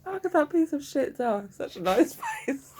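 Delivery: high voice cracking up with laughter; high, helpless amusement; real laughing fit. Real, not acted. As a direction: struggle to get it out before laughing. Helpless laughing fit over a doll.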